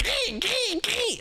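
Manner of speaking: high yelping gee gee; fox call. Shown as a demonstration. Showing chat what foxes say, high yelping. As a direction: yelp it like a fox. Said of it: "foxes are like".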